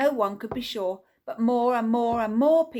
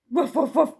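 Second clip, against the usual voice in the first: louder mock woofs; mock dog barking; 4 semitones higher, 6 dB louder than usual. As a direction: bark like a dog, retelling. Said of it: "pretending he's a dog".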